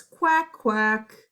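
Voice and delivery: loud first quack, then a whispered one; duck quack for kids. Shown as a demonstration. Teacher quacking for the class, loud then whispered. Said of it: "This animal says".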